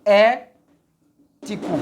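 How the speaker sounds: "ético" very slowly, loud É, pause, then "tico"; drawn-out "ético" broken up for a class; syllable by syllable. Shown as a demonstration. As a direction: punch the first syllable of "ético" and separate the rest. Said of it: "A primeira sílaba é".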